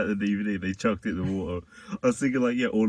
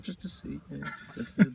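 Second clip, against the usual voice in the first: breathy unvoiced whisper, then a raised put-on voice; whispered aside; 6 semitones higher, 5 dB quieter than usual. Friend mocking the mugshot photographer, whispered then high. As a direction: hush the first words, then pose him.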